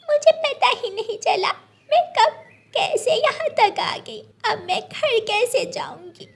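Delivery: put-on a small child asking how to get home; little bird child. Gudiya asking the cloud for help, high worried child voice.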